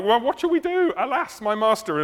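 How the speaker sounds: high, rushed cry of a mock panicking servant. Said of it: "the servant is, like, panicking".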